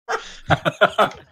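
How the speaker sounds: high, loud burst of laughter; amused laugh. Real, not acted. Game master tickled by the curtsy, loud high laugh. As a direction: crack up out loud.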